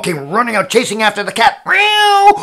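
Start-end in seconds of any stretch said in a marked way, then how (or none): 1.6-2.3 loud, high meow; cat yowl. Acted. Storyteller voices a cat fleeing the dog, loud high meow.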